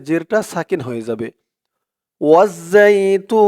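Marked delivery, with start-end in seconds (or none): 2.2-3.5 ওয়াজ্জাইতুন with the final vowel stretched three alifs, slow chant; recited with long madd. Shown as a demonstration. Quran teacher showing students the stop, slow recitation. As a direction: hold the long vowel before তুন, slow and even. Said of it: "তিন আলিফ টান দিয়ে এই নুনকে ধরবে".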